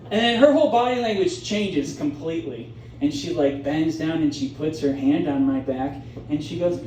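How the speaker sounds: normal talking voice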